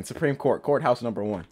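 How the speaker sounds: normal talking voice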